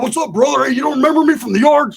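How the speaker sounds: hammed-up excited greeting; mock friendly con voice; eager friendly greeting. Voicing Roach greeting Theo.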